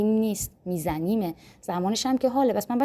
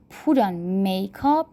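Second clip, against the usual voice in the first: slow model phrase; much slower than usual. Shown as a demonstration. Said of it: "model repeat".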